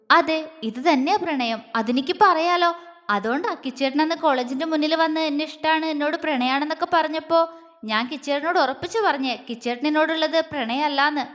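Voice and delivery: ordinary delivery, nothing marked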